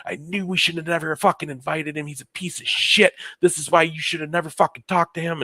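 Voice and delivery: fast, high angry tirade; scolding mom. Retold or imitated. Mom berating her bawling son about his father, angry scolding.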